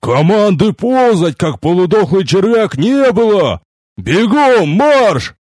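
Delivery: high, slow drill-sergeant shout; barked command. A narrator's reading. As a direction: bellow after a dawdling recruit, stretching each word. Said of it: "как вдогонку рявкнули". (рявкнуть вдогонку командным голосом)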